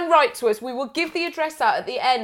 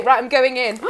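normal talking voice

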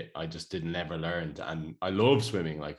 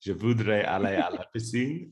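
a hint of put-on French accent; school French. Shown as a demonstration. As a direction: recite the classroom phrase. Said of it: "I always remember".

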